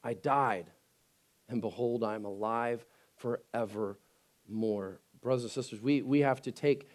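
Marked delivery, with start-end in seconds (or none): 0.0-0.7 much softer, lower, awed; preacher quietly repeating the promise; hushed reverent quote. Acted. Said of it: "reverent repeat of quote". 1.5-2.0 hushed behold. Acted. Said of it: "hushed repeat". 2.0-4.0 much softer, lower, awed; preacher quietly repeating the promise; hushed reverent quote. Acted. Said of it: "reverent repeat of quote".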